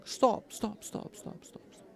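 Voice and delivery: a high mock yell that fades to a whisper; imitating the master shouting stop, fading out; retold scream fading. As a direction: call out stop, then let it die away. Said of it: "he was screaming".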